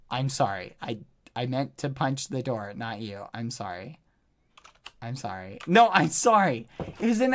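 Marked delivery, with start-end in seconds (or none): 0.1-5.6 sheepish apology. Real, not acted. After hitting friend by accident.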